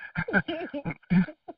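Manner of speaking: high, light giggle; real laugh at your own story, pitched high; amused laugh. Real, not acted. Laughs at own retold exchange.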